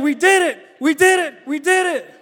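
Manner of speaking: high, loud jubilant chant; overjoyed repeating; joyful stranger. Retold or imitated. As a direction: celebrate with a stranger mid-hug.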